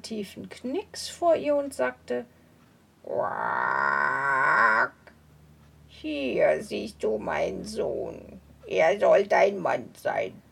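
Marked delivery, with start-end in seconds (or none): 3.0-10.4 low croaking toad, hushing. Acted. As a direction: croak a warning like an old toad. Old toad presents son.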